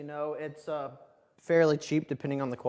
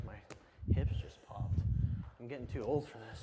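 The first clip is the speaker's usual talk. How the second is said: whispered complaint; 4 semitones lower, 7 dB quieter than usual. Real, not acted. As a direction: whisper it to yourself after the hips pop. Hips popped mid-lunge.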